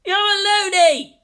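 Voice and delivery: high, loud, slow jeering shout. A narrator's reading. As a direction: heckle him. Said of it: "someone shouted".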